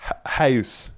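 high, "house" said like "heis"; Irish accent imitation; mock Irish. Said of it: "the Irish couple saying".

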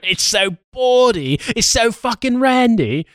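high, slow hammed-up posh voice; affected theatre-critic voice; mock British. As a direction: camp it up with sarcasm. Mock posh sarcastic praise.

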